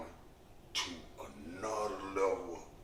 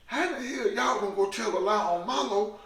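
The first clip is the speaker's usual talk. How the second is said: indignant mother; 9 dB louder than usual. Retold or imitated. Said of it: "Her mother said".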